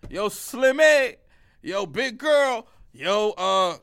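drawn-out, high mock catcall. Catcaller to women of any body shape, loud stretched-out call. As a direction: holler slowly at someone passing, loud and high.

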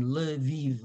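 Lviv as l-uh-VEEV, a small vowel after the L. Shown as a demonstration. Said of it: "put in some sort of a vowel in between".

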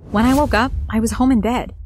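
ordinary delivery

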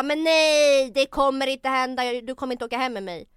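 loud, insistent shout of refusal; retold yelling. Woman retells shouting no at a pushy date on the subway. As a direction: yell it so the whole carriage hears. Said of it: "Skrik inte!".

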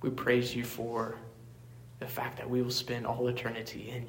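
soft, hushed pastor's prayer; quiet closing prayer to God. Acted. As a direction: pray it low and reverent, unhurried.

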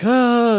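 mock scared yelp. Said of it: "and she's like".

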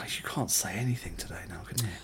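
whispered teasing aside. Real, not acted. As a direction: tease her under your breath. Teasing aside about pronunciation.

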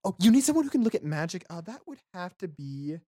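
high, quick character voice; Jala. Acted. Game master retries Jala answering about magic, quick high voice. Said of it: "that was not Jala's voice either".

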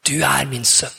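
tender fatherly voice, gently; a hint of a loving father reassuring; warm, loving voice. God telling Kenneth who he is, tenderly. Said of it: "en varm, kjærlighetsfull stemme".

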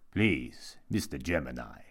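low, condescending restraint; sinister monk. A narrator's reading. Monk checks Jimmy's outburst, low and condescending.

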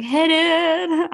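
high, loud sing-out of a greeting; lightly voiced cop greeting, drawn out high; imagined friendly cop. Imagining a cop walking up to her, high friendly greeting. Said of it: "came up to me and was like".

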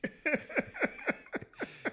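a real laugh that shoots up very high. Real, not acted. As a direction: crack up picturing the scene. Laughing at studio scene.

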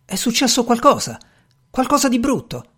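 domanda allarmata, molto più acuta; sorpreso. A narrator's reading. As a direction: chiedi sorpreso, alzando la voce di tono. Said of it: "fissandoli tutti sorpreso".

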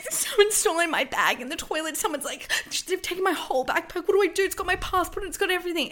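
high, loud panicked voice; panicked sister. Retold or imitated. Sister running out of the toilet, high panicked. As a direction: blurt it out in a panic.

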